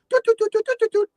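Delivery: high sing-song doot tones; phone dial tones. Retold or imitated. Storyteller mimicking the phone dialing Mario, high beeps.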